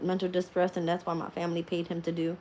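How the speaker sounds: usual speaking voice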